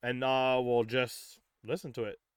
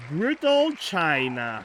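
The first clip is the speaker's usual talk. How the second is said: loud, slow, drawn-out announcement; announcing a name; 3 semitones higher, 7 dB louder, slower than usual. Real, not acted. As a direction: announce the wheel's pick, stretching it out loudly.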